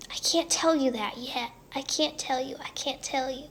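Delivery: ordinary talk, nothing marked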